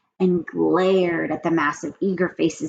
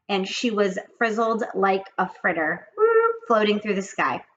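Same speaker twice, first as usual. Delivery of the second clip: plain reading voice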